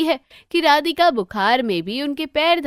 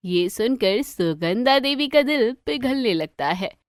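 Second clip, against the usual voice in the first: usual speaking voice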